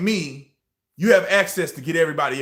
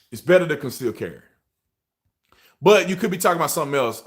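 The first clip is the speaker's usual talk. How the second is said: ordinary talk, nothing marked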